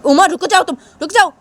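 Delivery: loud, firm stop; firm command. Acted. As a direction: order them to stop. Mother stops Uma. (ऊँचा, सख़्त आदेश)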